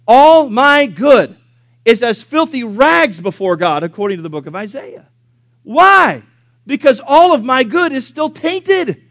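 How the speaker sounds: preaching at full force. Acted. Preacher rising on sin's taint, full-force preaching. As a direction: preach it like a fiery revivalist.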